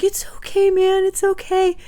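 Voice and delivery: playfully imitating her younger self calming a crying boy; mock soothing kid hero. Retelling how she comforted crying Ben in the outhouse, in a high soothing mock voice. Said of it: "And I was like".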